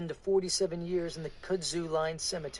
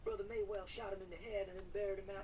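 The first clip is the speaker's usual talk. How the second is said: a higher, subdued woman's voice; weary woman; 6 semitones higher, 10 dB quieter than usual. Acted. Woman recounting her pastor's death, subdued. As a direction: tell it wearily.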